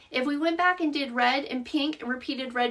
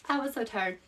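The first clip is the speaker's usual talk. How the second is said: soft repeat; 2 semitones lower, 6 dB quieter than usual. Real, not acted. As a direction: drop the voice and say it to yourself. Repeats it softly to herself.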